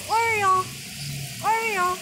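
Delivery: calling out. Shown as a demonstration. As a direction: chant the bird name out. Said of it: "you're gonna go".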